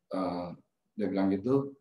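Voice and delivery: usual speaking voice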